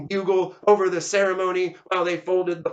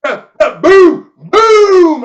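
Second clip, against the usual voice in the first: imitated gun volley; 11 semitones higher, 15 dB louder, much slower than usual. Narrator sounding third volley, loud imitated booms. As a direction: stutter into high, huge booms.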